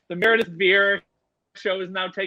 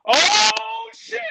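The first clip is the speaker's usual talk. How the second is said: high, drawn-out alarmed yell; stretched high cry of alarm; mock panic; 13 semitones higher, 5 dB louder than usual. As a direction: imitate a crowd spotting danger, loud and high. Band members reacting to loose baboon.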